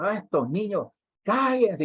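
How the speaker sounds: hammed-up snap of a worker hushing children; mock yelling servant. A church worker yells at the noisy kids, half-mocking shout. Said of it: "y comienza a gritarles".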